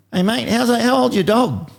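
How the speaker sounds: put-on high, boyish kid's voice; little kid voice. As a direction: squeak it up like a small boy on a bike. Retells a little kid's question.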